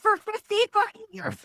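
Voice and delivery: retold high-pitched disbelief; incredulous outburst. Daughter confronting mom over the name, high incredulous outburst. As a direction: squeal it in mock outrage.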